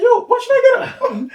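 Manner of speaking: high, loud, laughing disbelief; laughing protest. Retold or imitated. Player laughing back at the referee's order. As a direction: protest through laughter, half joking.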